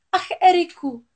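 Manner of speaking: high, loud cry of relief; joyful cry. A narrator's reading. Ariel with her voice back crying out to Erik, high and joyful. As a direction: call out to him, overjoyed. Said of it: "vykřikla Ariel".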